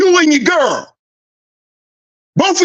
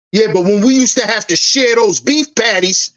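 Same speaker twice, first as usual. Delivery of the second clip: ordinary talk, nothing marked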